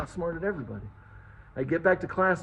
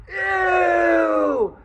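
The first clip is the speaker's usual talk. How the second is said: high, loud mock girl's squeal of disgust; disgusted shriek; 13 semitones higher, 7 dB louder than usual. Girl spots poop on shoe.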